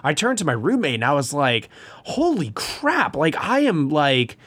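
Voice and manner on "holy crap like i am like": high-pitched, drawn-out retold excitement; hyped-up fan stretching out the big words; hyped exclamation. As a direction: relive gushing to your roommate, buzzing. Retelling hyped reaction to roommate after film.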